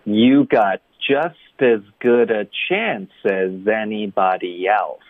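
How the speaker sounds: slow, even model sentence with every word joined; fully linked speech. Shown as a demonstration. Teacher repeating the sentence for learners, linked speech. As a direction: link slowly, keeping each "as" weak. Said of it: "老师再来一遍啊".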